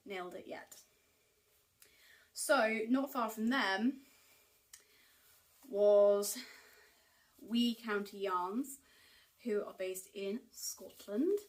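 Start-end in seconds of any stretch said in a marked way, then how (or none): 2.3-11.4 a hammed-up, slow Scottish accent, first words whispered; lightly put-on Scottish lilt for a yarn shop name; terrible Scottish accent. Knitting vlogger naming a Scottish yarn shop, in a mock Scottish accent. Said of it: "that was a really terrible Scottish accent".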